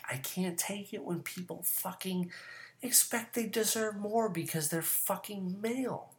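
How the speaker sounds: high, strained exasperation; exasperated. Real, not acted. Host fed up with entitled men, exasperated.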